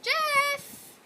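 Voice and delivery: high call after Jeff. A narrator's reading. Nora calls after Jeff, high calling voice. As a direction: call his name as he's pulled away.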